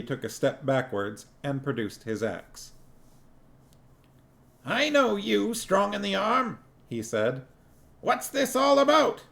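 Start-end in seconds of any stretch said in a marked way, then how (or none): 4.6-6.6 much higher, alarmed little dwarf voice; a high voice for the dwarf Cuddy, alarmed. A narrator's reading. 8.0-9.2 much higher, alarmed little dwarf voice; a high voice for the dwarf Cuddy, alarmed. A narrator's reading. Cuddy confronting dwarves.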